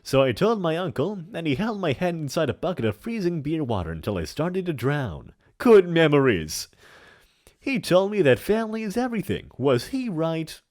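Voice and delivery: put-on big theatrical old villain voice; hammy stage actor. Plays a hammy character in chat.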